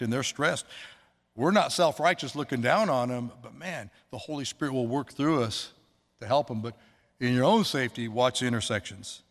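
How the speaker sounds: usual speaking voice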